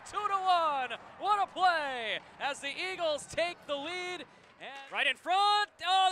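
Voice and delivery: normal talking voice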